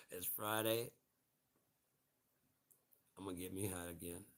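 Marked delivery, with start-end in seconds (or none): none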